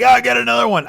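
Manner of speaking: lightly imitated gruff guy, high and loud. Guy throwing a second cinder block, mock gruff shout. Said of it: "like, a gruff voice".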